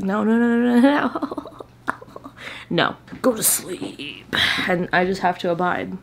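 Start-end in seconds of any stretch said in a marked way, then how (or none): 0.0-1.4 high, loud rising string of no's; playfully refuse, climbing higher and louder; mock refusal. Body overruling the reader at bedtime, mock refusal. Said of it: "Like, my body just decides. It's like". 2.7-4.3 slow, drawn-out playful command; mock bedtime order. Body ordering the reader to bed, drawn-out mock command. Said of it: "Like, my body just decides. It's like".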